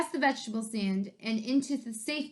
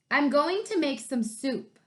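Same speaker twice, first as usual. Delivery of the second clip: gruff varmint; 5 semitones higher than usual. Acted. Varmint answers grumpily.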